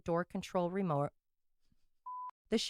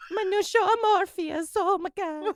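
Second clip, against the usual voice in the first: mock Italian Gucci actor; 2 semitones higher, 5 dB louder than usual. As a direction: ham up the actor's accent. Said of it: "my new favorite noise to make".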